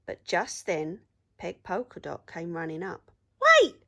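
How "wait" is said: very loud, high urgent cry. A narrator's reading. Peg running up to stop the pirates, loud cry. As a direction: call out to stop them. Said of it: "she cried".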